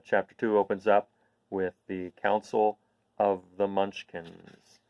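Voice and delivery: plain reading voice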